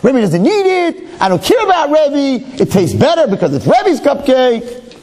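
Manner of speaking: put-on high, slow, giggly bratty kid voice; mock kid. Rabbi imitating the student munching his cupcake, bratty. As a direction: play the cheeky boy with a laugh.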